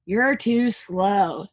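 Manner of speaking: slow, drawn-out tease; teasing Ernie. Acted. Ernie teasing Bert while drying dishes, slow. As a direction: stretch it out mockingly. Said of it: "said Ernie".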